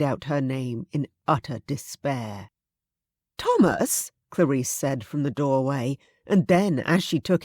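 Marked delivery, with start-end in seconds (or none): 3.4-4.1 higher, startled lady's call; Clarice's voice. Acted. Clarice calling Thomas from the door, higher and startled. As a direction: call his name from the doorway.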